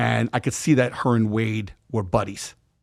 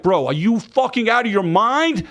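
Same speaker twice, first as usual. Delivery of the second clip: higher, louder, disbelieving yell at the friend; angry outburst; 9 semitones higher, 3 dB louder than usual. Retold or imitated. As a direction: tear into him, outraged. Berating friend over pregnancy.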